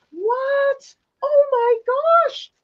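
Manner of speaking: high, astonished gasp of delight; surprised squeal. Real, not acted. Unboxing a viewer's gift, high surprised squeal.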